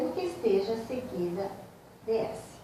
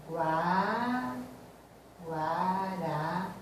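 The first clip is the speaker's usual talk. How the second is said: slow, softer, stretched syllables; syllable-by-syllable dictation; 2 semitones lower, 6 dB quieter, much slower than usual. Shown as a demonstration. Teacher writing out an example for students, slow dictation. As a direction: draw out each syllable as if writing it.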